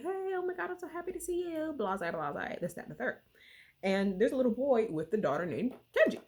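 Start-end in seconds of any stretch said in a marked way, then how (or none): 0.0-1.7 much higher, bubbly mock-excited hello; high sing-song fake delight; gushing greeting. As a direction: gush like an overjoyed mom. Mother greets daughter at camp.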